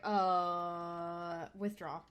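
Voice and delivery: low, very soft deadpan; quiet, drawn-back mock withdrawal; bored reluctance. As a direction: imitate an unimpressed guest backing out. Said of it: "could not have looked more bored".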